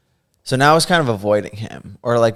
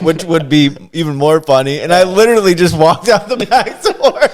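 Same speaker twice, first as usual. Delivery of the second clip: normal talking voice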